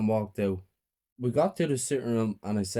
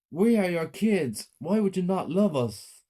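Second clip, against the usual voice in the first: high, slow pleading cry; anguished plea; 9 semitones higher, 4 dB louder than usual. A narrator's reading. Child to the mother, anguished plea. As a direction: beg for her love.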